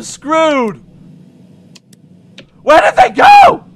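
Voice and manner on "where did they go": high, loud frantic question; panicked shout. Real, not acted. Player cannot find the squirrels, panicked shout. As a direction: yell it in alarm.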